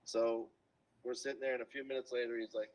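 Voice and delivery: usual speaking voice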